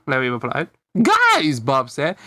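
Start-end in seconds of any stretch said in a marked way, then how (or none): 1.0-1.6 high, loud call to friends; raised, high-pitched hail. Acted. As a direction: call out to get their attention. Bob calls his friends over.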